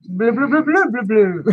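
high, gurgly blue-blue fish babble; bubble speech. Pretend. Crafter doing the fish's bubble language, high babble. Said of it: "That's bubble talk".

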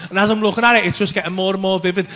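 normal talking voice

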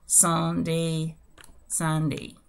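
drawn-out, evenly spaced model; slow demonstration. Shown as a demonstration. Teacher giving only the pronunciation to learners, slow and deliberate. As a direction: say the word slowly, then pause and repeat. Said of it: "lo voy a hacer de manera lenta y despacio".

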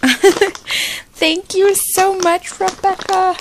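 slow thank you through laughter; laughing thanks. Real, not acted. Vlogger thanks sister Rebecca while laughing, slowly.

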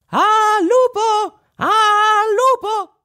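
tiny high voice shouting out loud. A narrator's reading. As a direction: yell out from inside the wolf's belly. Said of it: "si mise a gridare". (grida con vocina acuta)

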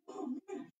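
usual speaking voice

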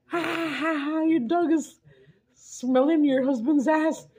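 high, slow laughing delivery; cracking up while teasing a friend; laughing while talking. Retold or imitated. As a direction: laugh through the words, high and drawn out. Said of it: "I said".

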